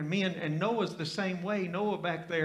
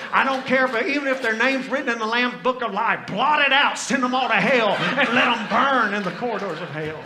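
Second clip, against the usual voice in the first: higher, louder hammed-up fire-and-brimstone rant; mock furious prayer; 5 semitones higher, 10 dB louder than usual. Pastor reenacts angrily praying to God against Democrats. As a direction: call down fire like the sons of thunder.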